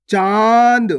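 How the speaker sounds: चांद with a long, nasalised vowel. Shown as a demonstration. Teacher showing learners chandrabindu, long nasal चांद. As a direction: draw out चांद loudly through nose and mouth. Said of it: "नाक और मुंह दोनों से".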